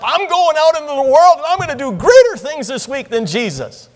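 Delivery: high, loud mock zeal; hammed-up rallying cry of an overeager believer; acting all fired up. Preacher mimics misled churchgoers rolling up their sleeves, all fired up. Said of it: "they get all fired up".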